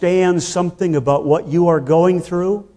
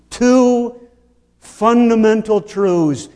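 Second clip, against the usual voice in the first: usual speaking voice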